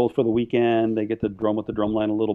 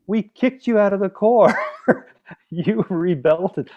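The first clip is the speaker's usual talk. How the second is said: high, slow, drawn-out disbelief; incredulous; 8 semitones higher, slower than usual. Retold or imitated. Reminding an expelled member why he's unhireable, incredulous. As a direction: stretch the words out in high-pitched amazement. Retells incredulous refusal of job seeker.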